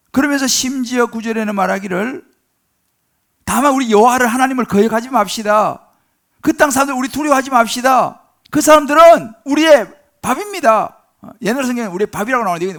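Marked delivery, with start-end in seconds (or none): 3.4-11.0 loud, higher, impassioned exhortation; fervent preaching. Acted. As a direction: proclaim it with burning conviction. Joshua and Caleb cry out. (여호수아와 갈렙이 백성에게 외치듯 열정적으로)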